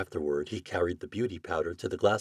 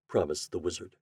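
usual speaking voice

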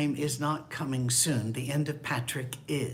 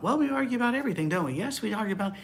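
high, fast chirpy agreement; couple agreeing; 7 semitones higher, much faster than usual. Retold or imitated. Pastor imitates couple agreeing, high and quick. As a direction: rattle it off brightly in sync. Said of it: "couple quoted".

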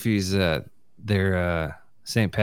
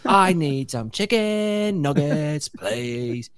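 high, drawn-out Valley Girl meets bad British accent; mock Valley-Girl British; 9 semitones higher, 5 dB louder, much slower than usual. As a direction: stretch each word in a hammed-up fake British Valley Girl accent. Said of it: "That's what that accent is. It's like fucking Valley Girl and shitty British".

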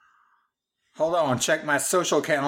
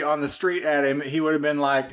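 normal talking voice

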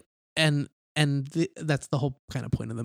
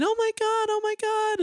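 imitating a panicked scream, much higher; mock scream. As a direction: shriek it like she found the body. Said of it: "she's, like, screaming".

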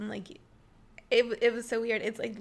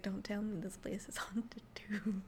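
hushed unvoiced whisper; 2 semitones lower, 7 dB quieter than usual. Coworker retells whispering to herself in the haunted clinic, unvoiced whisper. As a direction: breathe it scared under her breath. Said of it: "I was like".

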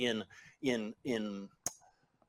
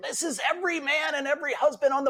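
high, loud mock outcry; hammed-up indignant wives, pitched way up and louder; exasperated women; 7 dB louder than usual. Voices women's outburst in quote.

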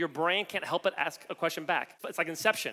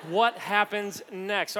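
slow suspense; much slower than usual. Acted. After the Braveheart line.

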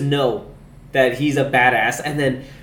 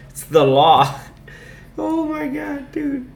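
ordinary delivery

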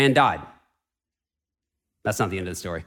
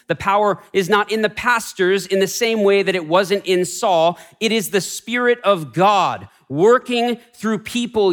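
raised, ringing pulpit voice; full-force preaching; 6 semitones higher than usual. Acted. Pastor proclaiming to the congregation, full-force preaching. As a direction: preach it out to the whole room.

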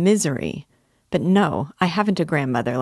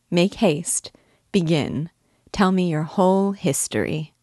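plain reading voice

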